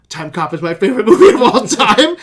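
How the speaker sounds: laughing while delivering the line; laughing through it. Retold or imitated. Felipe naming his favorite movie, cracking up. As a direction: barely keep the laugh in. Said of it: "And he goes".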